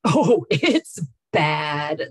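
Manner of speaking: imitating a dry, drawn-out verdict; mock-grave, droll impression of a funny colleague; dry deadpan. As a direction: stretch it out very slowly, loud and dry. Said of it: "She was so dry".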